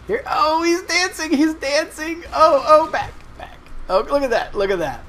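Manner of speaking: very high, loud thrilled shouting; excited. Real, not acted. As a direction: squeal with delight. Changelings dance as planned.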